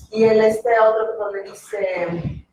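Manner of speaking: ordinary talk, nothing marked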